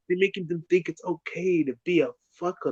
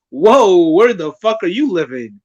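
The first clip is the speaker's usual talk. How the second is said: loud mock disbelief; acted shock; 7 dB louder than usual. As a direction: blurt out an exaggerated startled reaction. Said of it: "I was gonna be like".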